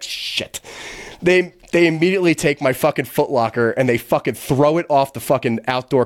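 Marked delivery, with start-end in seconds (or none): 0.0-0.6 breathy whispered dismay; whispered curse. Retold or imitated. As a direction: breathe it out, caught and doomed. Dismayed under his breath.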